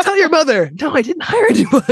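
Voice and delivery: loud, high shout to another room; yelling across the room. Retold or imitated. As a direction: call her in from across the house. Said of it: "And my dad is like".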